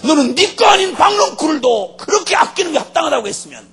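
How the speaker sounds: high, loud impassioned argument; forceful rebuke. Acted. God confronting Jonah over the vine, forcefully rebuking.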